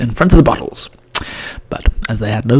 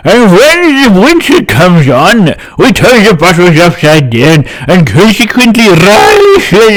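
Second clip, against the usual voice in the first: put-on loud, buzzy old fly, slow in places; old blue bottle fly explaining, loud and buzzing; buzzing accent; 3 semitones higher, 6 dB louder, slower than usual. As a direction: talk round a finger like a buzzing fly. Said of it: "now I have to do a buzzing accent".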